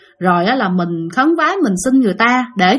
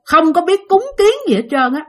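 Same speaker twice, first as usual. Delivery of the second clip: ordinary talk, nothing marked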